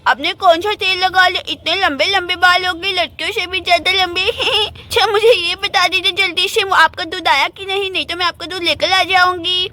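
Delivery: put-on childish lisping chatter; little girl Gudiya. Girl hurrying to fetch milk before school, childish lisp. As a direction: talk like a small lisping girl.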